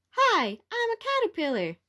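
put-on squeaky baby caterpillar hello. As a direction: greet like a brand-new baby bug.

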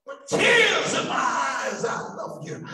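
loud, aching prayer to God; impassioned prayer. Acted. Preacher praying aloud before the congregation, impassioned. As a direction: cry it out to the Lord.

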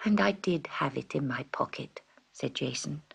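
whispered storytelling. A narrator's reading. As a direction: hush it, all breath.

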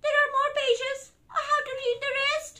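high, loud lament; mock distress. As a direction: wail about having to go on. Reader must keep reading.

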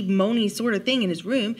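ordinary delivery, nothing marked

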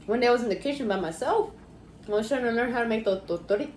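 eating between words; talking while chewing. Real, not acted. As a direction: mouth partly full. Seen 1: Bites food at -4, jaw and cheeks keep working while talking.

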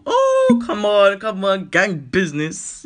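loud high plea, then slow; eager cheering; excited urging. Acted. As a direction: egg the fish on.

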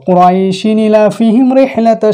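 slow recitation carried straight through; recited without stopping. Shown as a demonstration. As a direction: chant it in one breath, no stop. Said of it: "কুরাইশিন বলে চলে আসবো". (না থেমে টেনে তিলাওয়াত)

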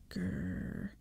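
very low drawn-out growl; low angry animal-like "grrr"; furious growl. A narrator's reading. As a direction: growl deep in the throat. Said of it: "I uttered a furious growl".

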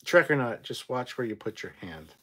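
normal talking voice

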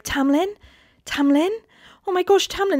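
high, rising, put-on innocent call; pretending. Feyre fakes confusion to Tamlin, high pretend helpless voice. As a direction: call out sweetly fake, pitched high. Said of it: "and then pretends, she's like".

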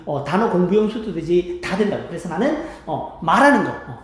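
ordinary talk, nothing marked

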